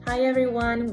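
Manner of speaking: ordinary talk, nothing marked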